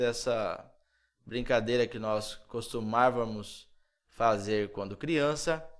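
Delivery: ordinary talk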